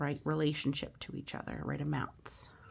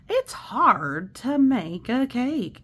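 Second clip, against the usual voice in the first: slow, louder, emphatic read-aloud; slow storybook line; 6 semitones higher, 9 dB louder, much slower than usual. Acted. Teacher reading the book aloud, slow and emphatic. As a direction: lean into the line for the kids. Reads rhyming picture book.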